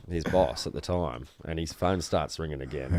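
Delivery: usual speaking voice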